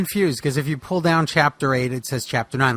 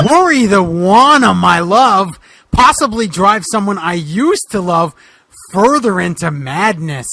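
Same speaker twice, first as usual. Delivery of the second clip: a touch higher, exasperated Boogeyman; frustrated detective rant; 4 semitones higher, 7 dB louder than usual. Acted. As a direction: vent like a cop at the end of his rope. S1 voices Boogeyman's outburst.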